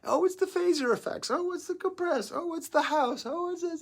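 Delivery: high, loud mocking voice; mock know-it-all. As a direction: parody the smug critic.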